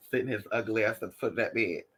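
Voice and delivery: ordinary talk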